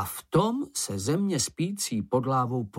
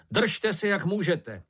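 higher-pitched, pressing call; alarmed shout, mildly raised; urgent warning; 7 semitones higher than usual. A narrator's reading. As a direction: call out to brace in danger. Raft flung into the air.

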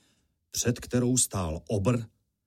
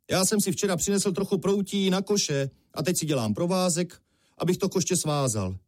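lightly voiced big fellow, pitched higher; casual strongman; 9 semitones higher than usual. A narrator's reading. Strongman to Honza about his rope, lightly voiced casual answer. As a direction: explain it lightly, in a raised pitch.

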